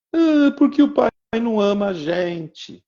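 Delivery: a hurt little daughter complaining through tears; whiny crying girl. Retold or imitated. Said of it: "eu ouvi minha filha chorando". (menina chorosa, voz aguda e lamentosa)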